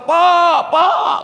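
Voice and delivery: mock pupil's high shout; calling out. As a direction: hail the passing teacher loudly. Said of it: "dipanggil pula".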